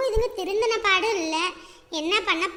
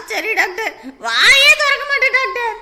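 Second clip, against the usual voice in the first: tearful shout; 8 dB louder than usual. Acted. Patient promising the doctor silence, bawling. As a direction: promise silence in a comic howl.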